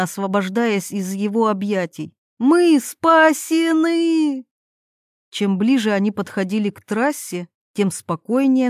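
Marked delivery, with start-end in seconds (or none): 2.4-4.4 high, very drawn-out cheer; jubilant shout. A narrator's reading. Irina freed from the forest, jubilant shout. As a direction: shout it to the skies, smiling. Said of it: "улыбнулась Ирина".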